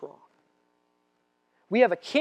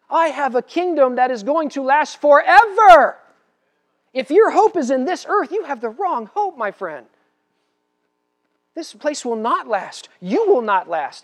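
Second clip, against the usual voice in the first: loud, slow, higher proclamation; climactic preaching; 7 semitones higher, 9 dB louder, slower than usual. Acted. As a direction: declare it with finality, word by word.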